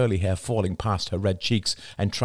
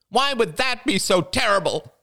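demanding. A narrator's reading. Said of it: "demanded Jada".

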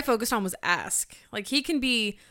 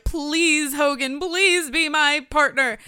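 slow, drawn-out mock pleading; hammed-up plea, higher on each please; mock begging; 4 semitones higher, much slower than usual. Co-host imitates the wrestler on his knees, hammed-up begging. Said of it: "getting on his knees, being like".

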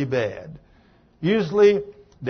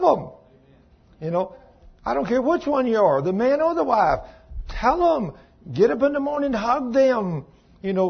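higher, louder preaching exhortation; fired-up preaching; 8 semitones higher, 3 dB louder than usual. Acted. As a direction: urge the whole congregation, climbing in pitch. Preaching rises to full force.

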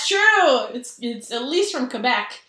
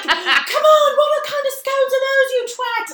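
high, loud mock outrage; hammed-up heckling shout; outraged heckler; 8 dB louder, slower than usual. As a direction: yell it at the screen like a rowdy sports fan. Acting out hecklers watching baking show.

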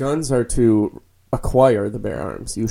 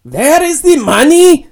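put-on high, slow, hammy German accent; 12 dB louder, slower than usual. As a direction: ham up a German guy faking Yiddish. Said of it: "let me hear your German accent".

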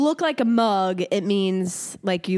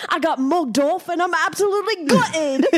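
laughing through a put-on British rant, higher; mock British accent; 7 semitones higher than usual. Stringing slang into one sentence, laughing mock British accent. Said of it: "make sentences out of all of these".

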